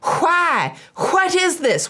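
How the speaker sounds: high, baffled Southern questioning; Southern accent. Acted. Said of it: "I had to say that in a Southern accent this time".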